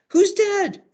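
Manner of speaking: very high, loud shrieked question; panicked Juliet. Acted. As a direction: demand it in mock hysteria. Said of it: "And Juliet's like".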